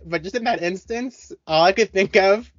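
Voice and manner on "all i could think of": ordinary talk, nothing marked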